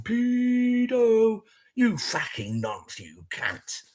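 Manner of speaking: mock crowd jeer. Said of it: "Everyone's shouting".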